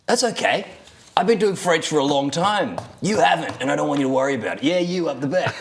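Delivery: lightly put-on Australian teacher, reassuring; a breezy Australian teacher calming a pupil; Australian accent. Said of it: "terrible Australian accent".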